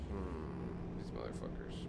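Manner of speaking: low, breathy whispered grumble; whispered grumble at the speeding ticket; whispered curse. Real, not acted. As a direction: hiss the curse under your breath.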